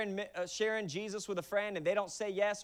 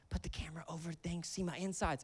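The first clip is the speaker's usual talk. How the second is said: mild quiet wife; 9 dB quieter than usual. Retold or imitated. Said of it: "Shauna's like".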